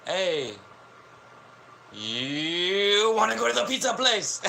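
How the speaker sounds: high, hammed-up put-on voice; mock Japanese American. Imagining Americans talking like that, high put-on voice. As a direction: ask about pizza in a squeaky fake accent. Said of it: "they'd be like".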